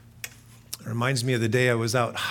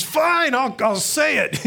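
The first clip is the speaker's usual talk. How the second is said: high, louder grudging give-in; reluctant friend giving in; 12 semitones higher, 6 dB louder, slower than usual. Retold or imitated. Hiking buddy Streeter finally gives in, grudging. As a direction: cave in like a worn-down buddy.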